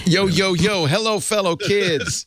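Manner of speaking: high, drawn-out mock-cool greeting; hip youngster act. Host greets the kids as one of them, mock-cool. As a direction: put on a cool-kid voice, pitched high.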